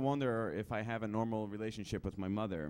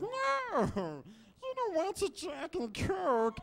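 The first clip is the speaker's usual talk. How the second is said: very high, slow creature whine; hammed-up split-personality creature, the timid side; Schmiegel; 3 dB louder, much slower than usual. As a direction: plead against it in a squeaky falsetto. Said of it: "a nice impression of Schmiegel".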